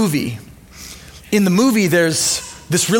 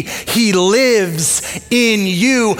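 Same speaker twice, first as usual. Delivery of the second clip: drawn out word by word; a preacher's stretched-out key line; slow emphatic line; much slower than usual. Acted. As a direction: land it slowly, each word weighted. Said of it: "You see".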